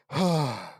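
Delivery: loud, heavy sigh; exasperated sigh. Real, not acted. Reader fed up with changing names, exasperated sigh. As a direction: let out a big weary breath.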